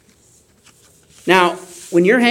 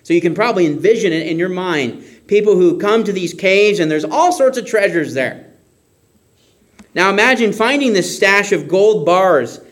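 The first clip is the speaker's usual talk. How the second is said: ordinary delivery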